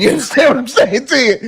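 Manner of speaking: high, loud, fast, through laughter; laughing. Real, not acted. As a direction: barely get it out for laughing.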